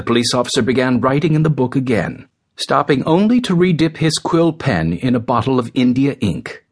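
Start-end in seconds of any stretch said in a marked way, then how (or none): none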